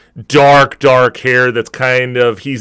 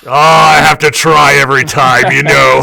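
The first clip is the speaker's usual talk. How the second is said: louder, higher, hearty barkeep greeting; orc bartender voice; 5 semitones higher, 7 dB louder than usual. Acted. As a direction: greet an old regular across the bar.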